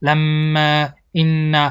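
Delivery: stretched nasal m in لما, stretched n in این; nasal ghunna. Shown as a demonstration. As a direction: hum the doubled m of لما and n of این through the nose.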